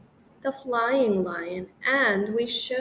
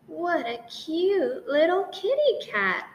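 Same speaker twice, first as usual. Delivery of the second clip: cooing lady; 6 semitones higher than usual. Acted.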